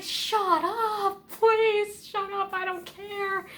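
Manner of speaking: loud, high, fed-up yelling back; exasperated yell. Retold or imitated. Yelling at the kid on screen, exasperated and loud. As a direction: shout it at the screen, totally done. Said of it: "I'm like".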